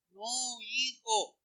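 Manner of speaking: soft, slow, drawn-out plea; pleading parent. Acted. Parent to son who likes drink, pleading. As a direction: softly lament over a son.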